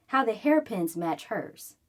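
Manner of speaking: ordinary delivery, nothing marked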